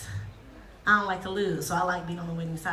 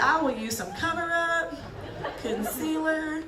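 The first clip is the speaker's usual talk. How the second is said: put-on teen making excuses; 6 semitones higher, slower than usual. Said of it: "You'd be like".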